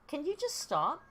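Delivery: high, fed-up plea to the cat; exasperated plea. Real, not acted. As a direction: beg the cat to hush. Pleading with meowing cat.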